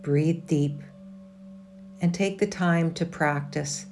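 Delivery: calm, measured meditation voice with long pauses; guided meditation. Acted. Yoga teacher leading a resting class through a meditation, calm and measured. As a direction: lead them inward in short, unhurried, evenly pitched phrases.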